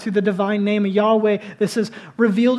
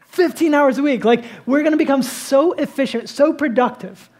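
higher, louder, incredulous sermon push; animated preaching; 5 semitones higher, 4 dB louder than usual. Acted. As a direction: build mock disbelief at the prediction. Preacher mocks Keynes prediction.

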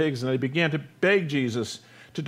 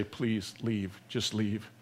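much softer, lower plea; subdued begging; 8 semitones lower, 11 dB quieter, much slower than usual. Retold or imitated. Townspeople begging Jesus to leave, a hushed plea. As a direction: quietly beg him to go. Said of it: "They're all begging him to leave".

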